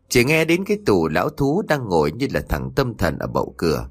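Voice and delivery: ordinary delivery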